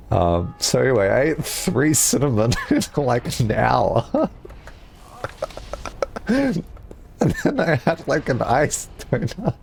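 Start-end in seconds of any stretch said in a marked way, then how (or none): 5.2-6.9 airy high-pitched giggle; high breathy laugh. Real, not acted. Laughs at eating so fast.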